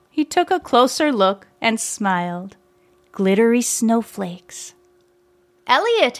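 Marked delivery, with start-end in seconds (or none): 5.7-6.2 high, joyful shout of a name; Tony spots the glitter and shouts for his old friend, high. A narrator's reading. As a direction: call out the name in sudden recognition. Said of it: "he shouted".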